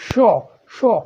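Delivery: शॉ drawn out with strong breath; demo of sha. Shown as a demonstration. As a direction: breathe out hard on शॉ. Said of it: "शॉ का अगर आप उच्चारण करेंगे".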